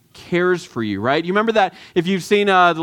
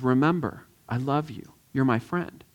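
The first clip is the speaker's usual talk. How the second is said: much softer, tender and reassuring; softened wizard; 6 semitones lower, 8 dB quieter than usual. Retold or imitated. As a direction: let the power drop and just comfort. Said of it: "but then he softens and he says".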